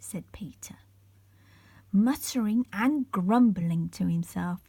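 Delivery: usual speaking voice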